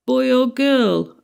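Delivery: slow, lightly voiced small boy, unsure; not too hopefully. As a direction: ask timidly like a little boy. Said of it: "not too hopefully".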